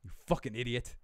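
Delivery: low-key, badly faked British accent with pauses; understated British-accented chef insult; Gordon Ramsay. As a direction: try a British chef's insult, held back. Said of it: "I can't do a Gordon Ramsay accent".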